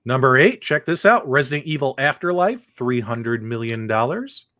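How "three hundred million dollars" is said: ordinary talk, nothing marked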